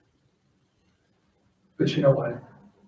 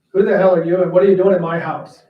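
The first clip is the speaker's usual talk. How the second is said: loud, gruff angry man; gruff demand; 4 semitones higher, 11 dB louder, faster than usual. Retold or imitated. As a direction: boom it like an intimidating father. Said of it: "and he says".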